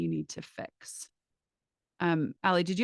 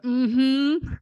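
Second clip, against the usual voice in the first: louder, higher knowing mm-hmm; imitating Allie; 6 semitones higher, 6 dB louder than usual. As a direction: mimic a friend's smug agreeing hum. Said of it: "she's like".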